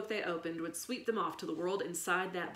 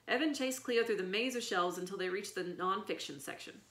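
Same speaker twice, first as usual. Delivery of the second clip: ordinary delivery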